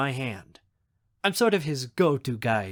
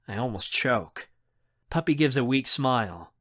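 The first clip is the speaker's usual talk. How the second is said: usual speaking voice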